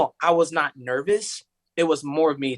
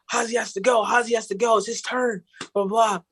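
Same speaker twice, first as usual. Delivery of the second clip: high-pitched, hurried mock urgency; high, insistent retold urgency; urgent producer; 8 semitones higher than usual. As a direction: imitate a frantic producer calling out.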